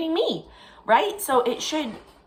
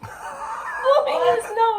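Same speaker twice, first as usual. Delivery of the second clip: laughing; 4 dB louder, much slower than usual. Real, not acted. Laughing at son's loud nose.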